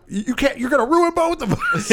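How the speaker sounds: very high, mock-panicked squeal; hammed-up alarm; panicked agent. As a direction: play an agent freaking out, voice shooting way up. Acted agent panicking at the news.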